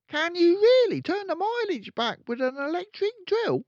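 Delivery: put-on a curious little boy asking; young boy voice. Son asking his dad, high and eager.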